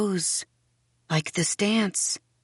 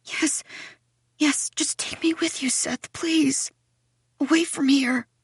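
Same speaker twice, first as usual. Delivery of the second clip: high, whispery begging; tearful pleading; 8 semitones higher than usual. A narrator's reading. Girl begging Seth to take her away, tearful pleading. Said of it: "I'm in tears".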